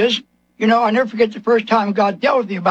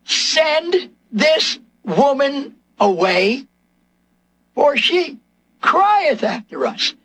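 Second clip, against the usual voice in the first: higher, drawn-out complaint of the apostles; acted indignant outcry; 4 semitones higher than usual. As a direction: cry out in raised-pitch, slow protest. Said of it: "They cried out to Jesus".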